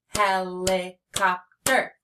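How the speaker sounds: "Helicopter" in four slow beats; "Helicopter" spoken to a clapping beat; clapped-out syllables. Shown as a demonstration. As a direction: chop "Helicopter" into hel, li, cop, ter. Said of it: "Let's clap them".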